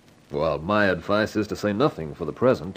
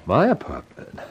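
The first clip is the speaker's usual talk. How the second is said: ordinary talk, nothing marked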